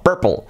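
strong P, vowel swallowed by R in "Purple"; English pronunciation. Shown as a demonstration. Teacher admiring the word, English pronunciation. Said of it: "Tenemos la P fuerte".

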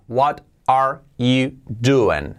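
"What are you doing?" slowly, each word apart; slow teaching model of "What are you doing?", unlinked. Shown as a demonstration. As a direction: pronounce "What are you doing?" carefully, with pauses between words. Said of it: "bien pronunciado palabra a palabra".

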